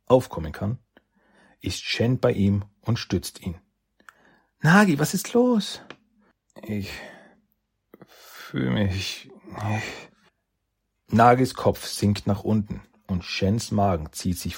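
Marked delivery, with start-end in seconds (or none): 4.6-5.8 alarmed. Acted. Shen alarmed as he collapses. 6.6-10.1 faint and fading. Acted. Nagi fading, about to faint.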